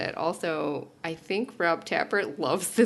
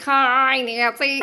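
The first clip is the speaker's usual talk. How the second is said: chirpy, louder and higher spoken greeting, not sung; telephone hour greeting; 5 semitones higher, 7 dB louder, much slower than usual. Shown as a demonstration. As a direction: call out a bright hi like a musical extra. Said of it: "'cause you just be like".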